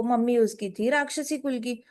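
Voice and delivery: ordinary delivery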